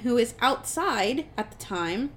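ordinary talk, nothing marked

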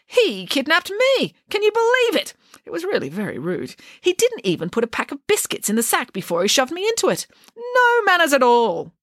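scandalised by such rudeness; indignant Nanny. Acted. Nanny indignant about kidnapping.

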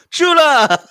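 high, loud, drawn-out mock cry; playful bellow that derails the talk; joke interruption shout. Shown as a demonstration. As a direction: burst in with a silly yell. Shows the interruption he plans.

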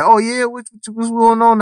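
high, excited imitation of a curious listener; mock eager questioner. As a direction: play the nosy guy asking about LA. Mimicking someone quizzing him on LA.

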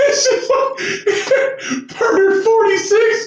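high, slow, crying laugh. Real, not acted. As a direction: wheeze it out laughing. Laughing repeat of punchline.

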